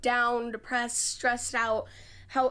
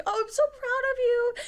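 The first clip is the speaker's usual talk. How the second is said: much higher gushing coo; gushing pride; 12 semitones higher than usual. Retold or imitated. Coach gushes over her student's win in a much higher voice. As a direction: gush it out, overjoyed. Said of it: "she's like ... freaking out".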